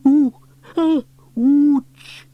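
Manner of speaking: high, loud hammed-up ouch; mock wail of pain. A narrator's reading. Fireman pretending pain during bandaging, hammed-up wail. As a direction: overplay the hurt. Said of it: "wailed Elvis".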